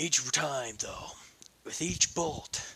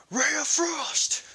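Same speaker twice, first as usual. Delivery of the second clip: high, slow shouted incantation; drawn-out hero's spell cry, pitched way up; 5 dB louder, slower than usual. A narrator's reading. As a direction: call out the spell like a battle cry. Casting a spell.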